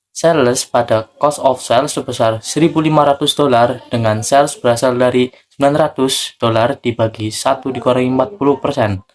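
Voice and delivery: usual speaking voice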